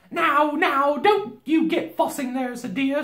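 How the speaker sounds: high motherly soothing; Missus Beaver. A narrator's reading. Beaver wife soothing the girl, high and motherly. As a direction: hush her in a high housewife voice.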